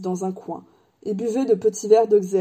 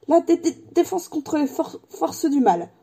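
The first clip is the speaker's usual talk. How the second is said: high, halting, nervous stutter; reluctant stammer; 7 semitones higher than usual. A narrator's reading. Professor reluctant to discuss his subject, nervous stammer. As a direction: stumble through it as if loath to speak. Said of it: "marmonna le professeur Quirrell".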